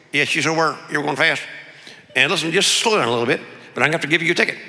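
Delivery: tight-lipped Texan officer; closed-mouth Texas accent. Acted. Sheriff giving a pastor a ticket, closed-mouth Texas accent. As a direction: scold lightly through a barely open Texas mouth. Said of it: "every other forty-something-year-old Texas guy talks".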